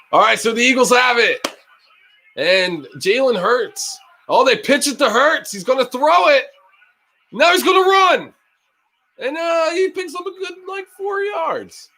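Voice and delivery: building from quick call to high shouted yards; excited play call. Real, not acted. Fan narrating Hurts' run to viewers, excited shout.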